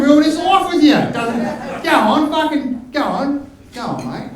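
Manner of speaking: high, pushy gambling-app pitchman, matey peer pressure; Aussie blokey ad. Acted. Blokey ad voice goading a mate to gamble, high and insistent. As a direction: egg a mate on like a blokey advert. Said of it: "make it seem really Aussie blokey".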